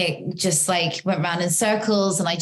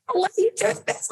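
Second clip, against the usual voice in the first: high, fast exasperated mom voice; a frustrated mother losing it at her son; retold angry outburst; 13 semitones higher, faster than usual. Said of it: "So then I got so angry".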